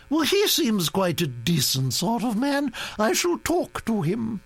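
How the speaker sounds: high, commanding grand-lady voice; a bossy old aristocrat laying down plans; imperious old Duchess. A narrator's reading.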